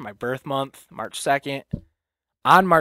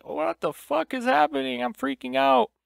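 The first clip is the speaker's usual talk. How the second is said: mock panic, much higher; panicked; 13 semitones higher, 3 dB louder than usual. Retelling his breakdown to listeners, imitating panic. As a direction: replay the panic attack, higher, a touch hammed-up. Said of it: "I was just like".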